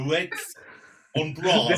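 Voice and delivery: a light mock Welsh accent. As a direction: tease him in a lilting Welsh voice. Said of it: "like in Welsh accent".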